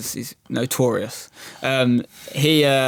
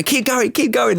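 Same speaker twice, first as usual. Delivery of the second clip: much higher, urgent cheering; high excited urging; excited egging on; 10 semitones higher, slower than usual. Retold or imitated. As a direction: egg the driver on. Egging on the driver.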